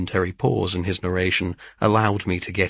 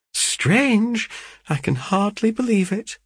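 high, incredulous echo; astonished and doubting, voice lifted high; amazed disbelief; 13 semitones higher than usual. Acted. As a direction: question it in high-pitched wonder. Mother amazed at Rose's news.

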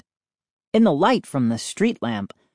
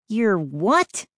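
surprised; 6 semitones higher than usual. A narrator's reading. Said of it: "Mindy cried".

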